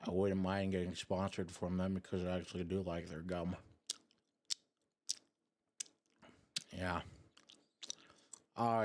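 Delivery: soft close-mic ASMR voice. Real, not acted. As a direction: murmur softly, chewing gum close to the mic. ASMR gum chewing for chat.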